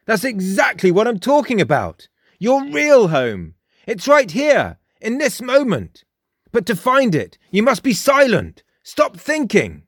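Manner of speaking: high, emphatic character voice; insistent Frost. A narrator's reading. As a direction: urge it like a self-assured young guru. Frost lectures Jake.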